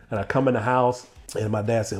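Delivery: normal talking voice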